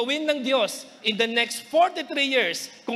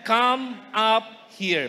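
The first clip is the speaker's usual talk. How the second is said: slow invitation; much slower than usual. Acted. Preacher repeats the invitation.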